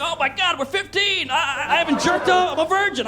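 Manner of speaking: high-pitched whimpering protest, acted; hammed-up frightened teenager; mock teen panic. Teens watch police tase attacker, mock panic.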